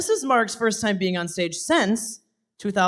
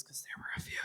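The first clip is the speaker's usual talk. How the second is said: whispered aside; 21 dB quieter, faster than usual. Real, not acted. Joking aside to the audience.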